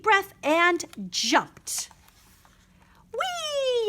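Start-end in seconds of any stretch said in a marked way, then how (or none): 3.1-3.9 very high joyful whoop; gleeful yell. A narrator's reading. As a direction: shot up out of the hole. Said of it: "yelled Angel".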